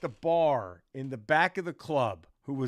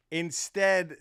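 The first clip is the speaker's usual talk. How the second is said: normal talking voice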